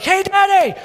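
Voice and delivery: high-pitched mock little-boy call; excited young son. Son calling to dad before leaping at him, excited. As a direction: call out like a boy about to leap. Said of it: "all of a sudden he's like".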